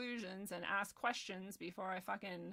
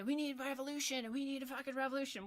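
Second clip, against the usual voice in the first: raised mock-activist chant; mocking imitation; 4 semitones higher than usual. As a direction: parrot a slogan with scorn. Mocking would-be revolutionaries.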